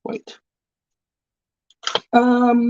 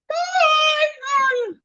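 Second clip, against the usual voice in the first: calling out hi. Real, not acted. Calling out to viewer.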